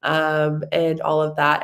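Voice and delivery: usual speaking voice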